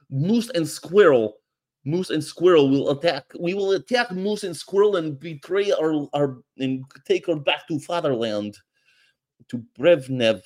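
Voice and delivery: put-on high, hammy Russian villain accent; Boris impression. Vlogger imitating Boris from Rocky and Bullwinkle in Russian accent. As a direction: ham up a cartoon Russian spy. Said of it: "these things with Boris and Natasha".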